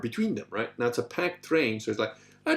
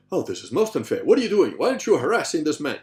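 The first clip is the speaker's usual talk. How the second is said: mock indignant; 5 semitones higher, 7 dB louder than usual. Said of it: "I would have been like".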